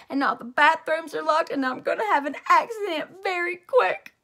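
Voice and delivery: loud, high, urgent pleading; child begging the janitor; desperate pleading. A narrator's reading. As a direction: rush it out, about to burst.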